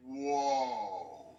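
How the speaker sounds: low, drawn-out awed whoa; retold amazement. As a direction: let it out long and deep, awestruck. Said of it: "I was like".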